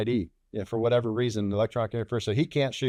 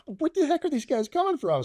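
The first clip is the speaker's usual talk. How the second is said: much higher, incredulous yelp; startled disbelief. Retold or imitated. Pilot hears of new bandits, high startled disbelief. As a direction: pitch it up high like you can't believe it.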